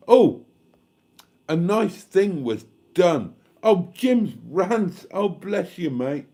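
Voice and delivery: high, loud, gushing thanks to donors; beaming with real delight at each gift. Real, not acted. Streamer thanking viewers for their donations, delighted and loud.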